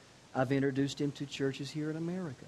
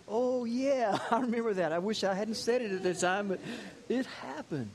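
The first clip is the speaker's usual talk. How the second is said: rueful laugh; 6 semitones higher than usual. Retold or imitated. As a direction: laugh it off, a little sheepish. Pastor recalls his own prophecy.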